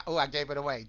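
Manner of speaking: normal talking voice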